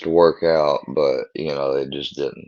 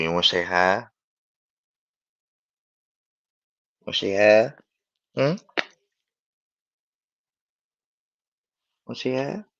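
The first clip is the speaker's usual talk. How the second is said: higher and higher, sing-song coaxing the dog; 8 semitones higher than usual. Real, not acted. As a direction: coo at the dog to say hi, climbing up in pitch.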